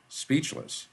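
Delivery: ordinary delivery, nothing marked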